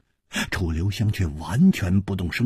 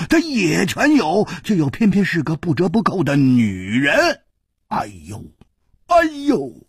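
much higher, animated listing; excited rant; 10 semitones higher, 3 dB louder than usual. A narrator's reading. Hu Tiehua lecturing Chu Liuxiang about women, higher. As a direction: rattle off the complaints with gusto.

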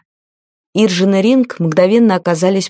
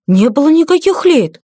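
higher-pitched, emphatic denial; confident crisp report; 8 semitones higher, 3 dB louder than usual. A narrator's reading. Guard denies that any ladies passed, confident higher-pitched report. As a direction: state it firmly like a guard reporting. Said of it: "уверенно отчеканил стражник".

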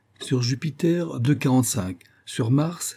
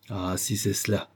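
ordinary delivery, nothing marked

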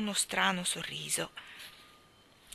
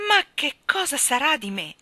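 higher, louder desperate cry; distraught king crying out; 7 semitones higher, 9 dB louder than usual. Acted. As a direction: cry out in despair. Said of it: "gridò il re".

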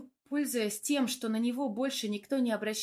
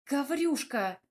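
higher, half-hearted protest; unconvincing little scolding exclamation; mild indignation; 4 semitones higher, 3 dB louder, slower than usual. A narrator's reading. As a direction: protest without real anger. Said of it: "возмутилась я".

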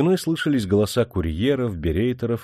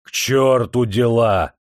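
loud, slow bellow; angry roar; 2 semitones higher, 6 dB louder, slower than usual. A narrator's reading. Prince dismisses his adjutant's reminder, roaring angrily. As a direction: roar it out, dragging the words angrily. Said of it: "заревел князь".

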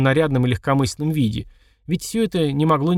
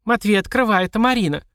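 calling out; 8 semitones higher than usual. Acted. Marina shouting at door.

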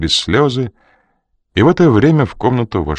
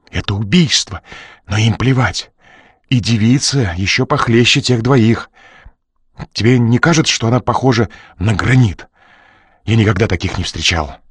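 lightly raised, jittery nervous man; nervous Crew; 2 semitones higher than usual. Acted. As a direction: fret like a man in trouble. Crew frets to Coridon.

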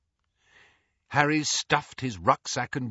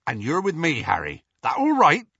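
Hagrid; 6 semitones higher, 5 dB louder than usual. A narrator's reading. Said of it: "looking a little anxious".

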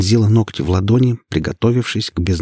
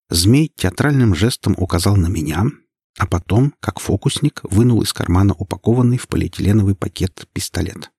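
usual speaking voice

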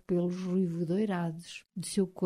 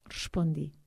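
normal talking voice

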